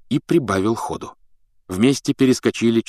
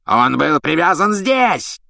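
slow, raised, enraged outburst; furious yell; 7 semitones higher, 3 dB louder, slower than usual. A narrator's reading. Executioner finding the beast gone, furious yell. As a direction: yell it in a rage, rising high. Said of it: "с бешенством заорал палач Макнейр".

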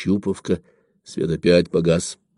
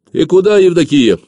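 high, loud, urgent call; raised, insistent yell; shouting after someone; 9 semitones higher, 6 dB louder than usual. A narrator's reading. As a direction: shout to stop a runner. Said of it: "крикнул Ефим".

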